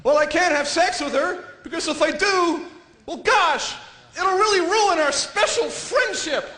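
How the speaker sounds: high, drawn-out goody-goody voice; prim half of a guy. Acted. Guy's decent half worrying about the friendship, high-pitched. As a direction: fret in a high, slow, innocent voice. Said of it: "One half's going".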